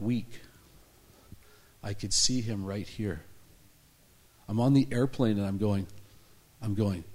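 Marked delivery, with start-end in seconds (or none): none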